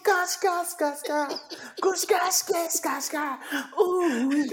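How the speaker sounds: very high excited squealing. Real, not acted. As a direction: squeal it like a giddy fan. Squealing over Roman Reigns.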